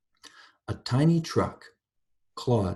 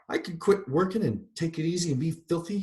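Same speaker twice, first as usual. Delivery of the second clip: a touch of a higher growl; 8 semitones higher than usual. A narrator's reading. Mrs. Turpin growling at God, defiant. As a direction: grumble in defiance. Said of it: "she growled".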